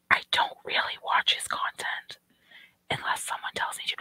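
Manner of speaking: soft unvoiced whisper. Real, not acted. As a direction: whisper it like a secret aside. Whispering an aside about content.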